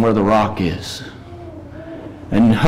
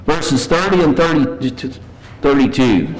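normal talking voice

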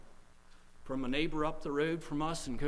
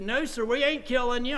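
raised, firm retold reply; frank insistence; 7 semitones higher, 5 dB louder than usual. Preacher retelling his answer to a drunk, frank and firm. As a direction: set him straight, loudly. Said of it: "I started getting kind of frank with him".

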